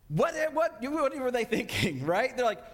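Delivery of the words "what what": ordinary delivery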